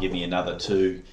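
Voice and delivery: ordinary talk, nothing marked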